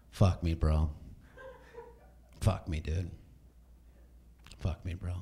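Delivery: low, fading bro moan. Pretend.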